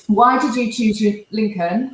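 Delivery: usual speaking voice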